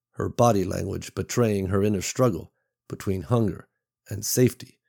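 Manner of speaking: ordinary delivery, nothing marked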